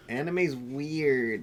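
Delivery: dismissive. Retold or imitated. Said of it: "that was still in my phase of".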